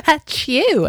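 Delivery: high-pitched mock Southern sneeze; Southern-accented sneeze. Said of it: "She sneezed in a Southern accent".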